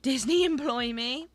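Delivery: high, loud plea to Disney. Real, not acted. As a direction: call out to be hired.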